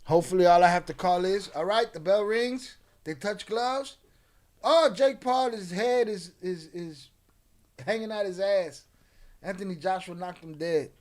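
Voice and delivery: loud ringside play-by-play; fight announcer. Shown as a demonstration. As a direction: call it like a boxing announcer. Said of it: "I'm gonna call the fight for you".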